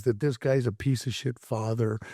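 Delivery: usual speaking voice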